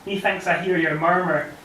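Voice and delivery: ordinary delivery, nothing marked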